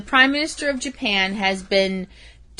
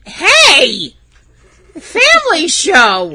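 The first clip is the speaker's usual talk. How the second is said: loud, slower mock reprimand; mock scolding; 8 semitones higher, 8 dB louder, much slower than usual. Real, not acted. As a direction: call it out like a strict chaperone. Jokingly scolding a rude joke.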